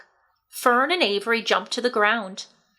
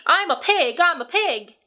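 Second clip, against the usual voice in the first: put-on excited boy; 7 semitones higher than usual. A narrator's reading. Boy playing pig.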